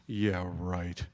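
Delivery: very low, soft scoff; deadpan disbelief. Retold or imitated. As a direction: brush them off as a doubting mom. Mom's disbelieving reply, acted.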